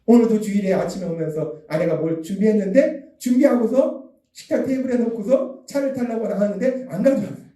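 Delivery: ordinary talk, nothing marked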